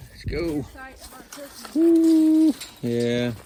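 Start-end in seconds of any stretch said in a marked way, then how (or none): 1.7-2.5 whoop. Real, not acted.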